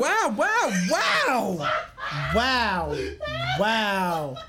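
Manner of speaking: high laughing shouts of disbelief. Real, not acted. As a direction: yell through laughter, scandalized. Friend exposes him, he reacts.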